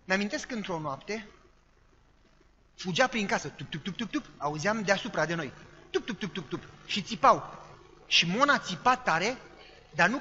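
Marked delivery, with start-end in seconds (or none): none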